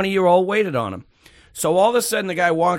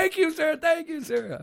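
very high, softer imitated girl voice; grateful cashier; 12 semitones higher, 5 dB quieter than usual. Cashier to the man who defended her, grateful. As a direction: imitate a timid, thankful young clerk.

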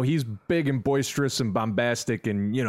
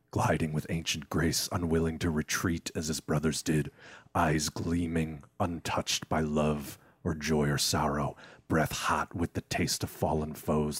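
dark narrator voice; 6 semitones lower, 4 dB quieter than usual. Acted. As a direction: growl it low like a creeping beast. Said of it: "that was fucking great".

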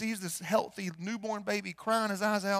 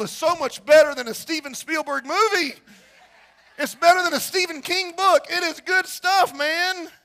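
loud, high, exuberant pulpit enthusiasm; full-force preaching; 6 semitones higher, 10 dB louder than usual. Real, not acted. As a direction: proclaim it with excited conviction.

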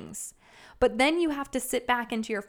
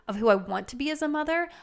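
ordinary talk

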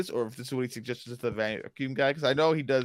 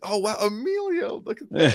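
mock excited gasp; 9 semitones higher, 3 dB louder than usual. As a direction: play the delighted surprise of a fan spotting a cameo. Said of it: "It went from".

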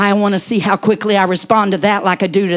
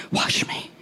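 soft whispered plea; 7 dB quieter than usual. Acted. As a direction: breathe it quietly. Whispered aside.

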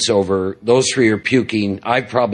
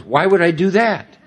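higher-pitched, baffled question; puzzled mother; 8 semitones higher than usual. Retold or imitated. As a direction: imitate a mother who sees no reason to. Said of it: "she looks at me and she goes".

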